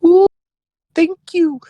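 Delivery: very high, slow falsetto granny; relieved old woman cooing her thanks. Acted. Old woman thanking the friend, high falsetto. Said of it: "And she goes".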